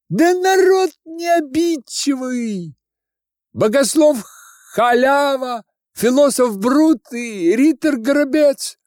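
very high, loud put-on voice; ingratiating student. Student introducing his companions to the old woman, high wheedling voice. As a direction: pipe up loudly to win her over.

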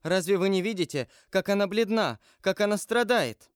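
high, urgent appeal; distressed, insistent questions; Валентина crying out. A narrator's reading. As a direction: plead in alarm, pitch lifted throughout. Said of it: "вскричала Валентина, поддерживая".

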